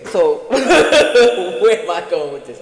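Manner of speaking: slow, loud laugh through the words; real laughter. Real, not acted. Laughing to viewers about her rambling story. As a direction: laugh at losing the thread.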